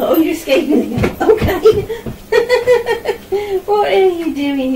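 normal talking voice

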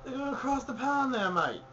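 hammed-up British accent with an Aussie twist; bad British-Australian accent. Host hyping the London show, in a bad British accent. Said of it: "the worst British and Australian accent ever".

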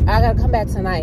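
talking around a retainer; slightly muffled retainer speech; wearing a retainer. Real, not acted. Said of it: "excuse me if I'm talking like this, 'cause I got my retainer in".

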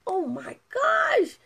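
high, slow amazed gasp; awed at the after photo. Real, not acted. Coach seeing an uncle's after photo, high and slow in amazement.

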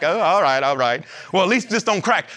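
mutter, sizing yourself up; deflated next to a handsome man. Retold or imitated. A man standing beside a handsome pastor, lightly deflated mumble.

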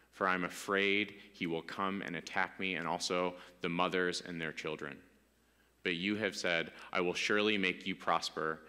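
low, soft, reverent reading of a prayer; solemn scripture reading. Acted. Pastor reads Jacob's prayer to the congregation, low and reverent. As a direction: let the prayer sit low and quiet.

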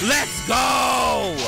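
loud excited shout; hype cheer. Real, not acted.

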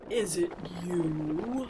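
slow, loud rumbling giant voice. A narrator's reading. The giant rumbling a question to Carolinda, rumbling. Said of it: "rumbled the voice".

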